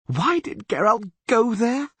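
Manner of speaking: slow, high anguished groan. Acted. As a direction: lament it aloud. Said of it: "groaned Dandelion".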